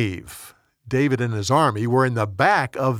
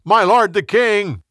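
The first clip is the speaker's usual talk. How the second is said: higher, louder call across a distance; storyteller's yell; 7 semitones higher, 5 dB louder than usual. A narrator's reading. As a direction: call out to the king, voice raised. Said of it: "went out and yelled".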